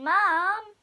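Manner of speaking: plain reading voice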